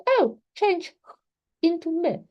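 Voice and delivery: put-on high, bright demo voice for a child; teacher demonstrating to a student, high playful voice. Shown as a demonstration. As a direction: play the teacher setting a sound task, pitched well up. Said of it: "And say".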